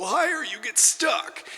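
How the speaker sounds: climbing high and slowing into a stuck note; strained climb. Shown as a demonstration. Imitates a voice straining upward.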